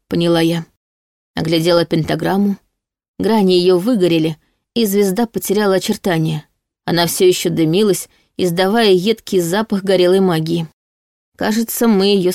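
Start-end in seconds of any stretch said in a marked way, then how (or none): none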